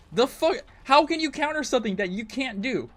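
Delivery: real indignant yelling; angry shout. Real, not acted. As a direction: yell it like you can't believe the design. Raging at killer game mechanics.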